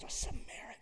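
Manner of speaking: whispered in scorn, voiceless; disgusted whisper. Retold or imitated. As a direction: hiss the name with disgust. Crowd's disgusted murmur.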